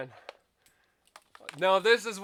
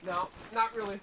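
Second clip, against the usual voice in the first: ordinary talk, nothing marked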